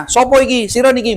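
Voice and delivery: coarse Javanese. Retold or imitated. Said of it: "bahasa Jawa kasar".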